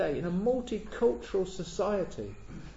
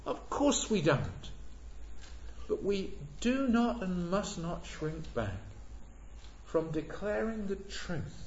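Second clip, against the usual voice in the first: ordinary delivery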